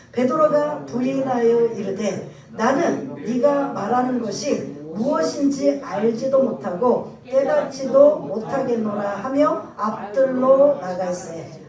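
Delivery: loud, slow congregational Bible reading; scripture reading. Acted. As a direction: lead the congregation through the verse, slowly and loudly. Leads scripture reading aloud.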